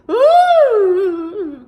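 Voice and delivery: very loud, very high frightened "Ooh"; terrified shopper's cry; startled shriek. Acted. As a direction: shriek and fling the bag. Lady startled near store.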